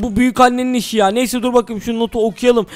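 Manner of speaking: ordinary talk, nothing marked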